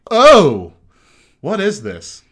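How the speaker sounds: high, loud shocked "oh"; shocked by the taste; surprised yelp. Retold or imitated. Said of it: "took a sip and went".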